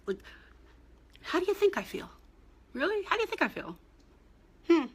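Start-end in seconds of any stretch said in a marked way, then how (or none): 1.2-4.9 high, loud and fed up; real exasperation rising to a loud peak; exasperated outburst. Real, not acted. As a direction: throw the question back, incredulous. Venting about insensitive questions.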